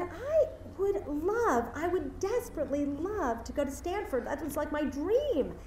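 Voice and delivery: put-on eager teenager lingering over his dream; yearning student. Student longs to attend Stanford.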